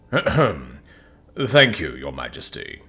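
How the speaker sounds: guard captain voice. Acted. Guard captain answers the king.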